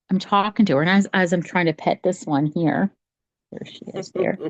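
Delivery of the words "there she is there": breathy whisper, pitched up and much softer; hushed aside to the listener. Real, not acted. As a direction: drop to a quick whisper about the cat. Aside about cat on camera.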